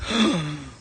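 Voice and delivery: imitated shocked gasp; mock gasp. Onlookers seeing her money, put-on shocked gasp. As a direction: suck in air as if stunned.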